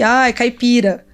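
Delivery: slow mocking "Ai, caipira"; mock mockery. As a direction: drawl out "caipira" with mock scorn. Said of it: "as pessoas vão rir". (zombaria fingida e lenta)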